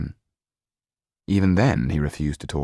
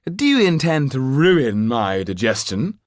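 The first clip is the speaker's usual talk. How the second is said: higher, louder, slow affronted whine; peevish Marquis; 7 semitones higher, 6 dB louder than usual. Acted. Marquis complaining to the lawyer, peevish and loud. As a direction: act wounded and put upon.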